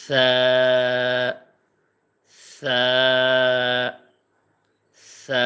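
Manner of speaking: light soft sa held one second; soft thin sa. Shown as a demonstration. Teacher showing students the soft Arabic sa. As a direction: breathe out a thin gentle sa. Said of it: "নরম করে, হালকা করে".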